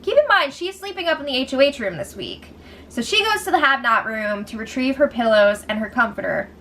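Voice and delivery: ordinary talk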